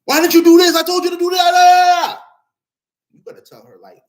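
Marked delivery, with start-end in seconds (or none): 0.1-2.2 mock screaming girlfriend. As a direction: screech it like a girlfriend losing it at him. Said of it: "basically trying to yell and scream at you".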